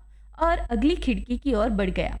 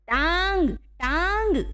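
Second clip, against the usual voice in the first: higher, drawn-out call of each word; raised voice, understated yell; mild shout; 5 semitones higher, much slower than usual. A narrator's reading. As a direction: call it out to someone hard of hearing. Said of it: "मैंने चिल्लाकर कहा".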